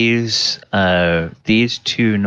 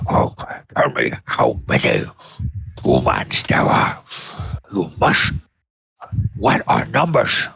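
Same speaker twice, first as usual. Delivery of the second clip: much lower, fast monster growl; deep gnoll voice; faster than usual. Acted. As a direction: gnoll leader pressing the bugbear, low. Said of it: "the gnoll leader says".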